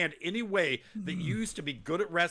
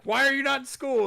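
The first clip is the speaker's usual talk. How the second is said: mock scolding; 9 semitones higher, 6 dB louder, faster than usual. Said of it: "he'd be like".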